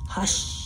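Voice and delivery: long, hushing shhh; quiet, drawn-out hush; sound of snow falling. Shown as a demonstration. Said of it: "what kind of sound snow makes".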